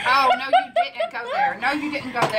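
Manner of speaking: high laughing mock outrage; mock offended. Real, not acted. Teased by her daughter about the burger, laughing mock outrage. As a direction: laugh while pretending to be insulted. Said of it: "I did".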